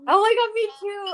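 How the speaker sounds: high, loud burst of recognition; excited agreement. Real, not acted. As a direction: jump in, thrilled to relate. Delighted recognition of a shared habit.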